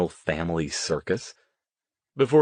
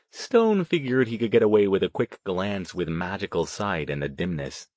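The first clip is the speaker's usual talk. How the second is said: usual speaking voice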